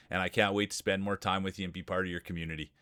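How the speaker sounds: ordinary talk, nothing marked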